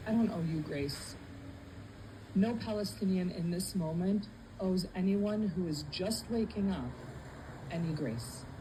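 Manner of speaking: ordinary delivery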